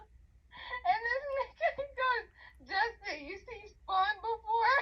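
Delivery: high, squealing-with-laughter punchline; laughing. Real, not acted. Delivering the movie punchline to a friend, laughing hard. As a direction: barely get it out for laughing.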